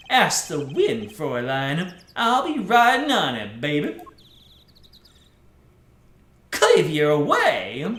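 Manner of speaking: a light take on a suave cool guy. Acted. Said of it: "my generic cool guy voice".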